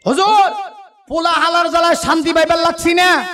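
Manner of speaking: put-on father pleading in old Dhaka dialect; Dhaka father complaining. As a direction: whine to the huzur like an old Dhaka dad. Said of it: "বাবা আইনা প্রিন্সিপালের কাছে".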